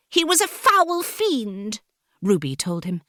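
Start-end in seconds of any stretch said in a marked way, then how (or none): 0.1-1.8 high, slow heroine voice; defiant heroine. A narrator's reading. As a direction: defy the villain in a high voice. Heroine defies the villain.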